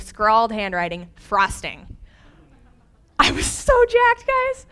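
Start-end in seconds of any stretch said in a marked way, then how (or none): 3.2-4.7 high-pitched, loud excited squeal. Retold or imitated. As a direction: gush with giddy delight. Thrilled at finding frosting.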